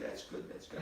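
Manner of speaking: unvoiced muttered reassurance; breathless half-hearted mutter; whispered aside. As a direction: say it to yourself, deflated. [name] muttering disappointment.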